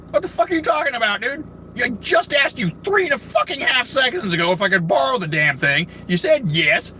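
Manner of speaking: high-pitched indignant comeback; indignant retort. Retold or imitated. Customer answers cashier's theft accusation, indignant. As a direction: fire back in exasperated disbelief.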